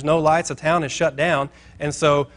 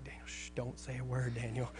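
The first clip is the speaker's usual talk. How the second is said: very soft, secretive mock aside; hushed warning; 3 semitones lower, 22 dB quieter than usual. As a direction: imitate quietly shushing a friend under your breath. Secretly hushing friend before the owner.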